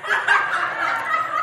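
a quick airless chuckle; short breathy laugh. Real, not acted. Laughing at her frozen fish joke, short breathy chuckle. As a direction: laugh off your own joke.

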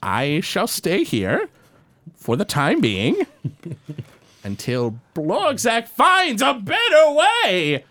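slow pompous goblin voice; megalomaniac rant. Acted. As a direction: announce it haughtily. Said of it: "Blorgzak speaks".

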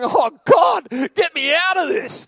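high, loud, laughing plea to God; hammed-up wail for rescue; mock desperate cry. Retelling his slog through Lamentations, a mock desperate cry.

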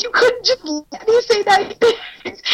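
high, hurt mock whine; whiny ex. Voicing the ex's hurt complaint, high and whiny. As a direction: whine like a wounded boyfriend. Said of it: "He's like".

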